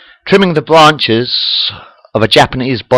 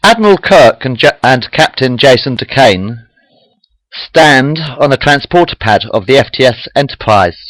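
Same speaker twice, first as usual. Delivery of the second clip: ordinary delivery, nothing marked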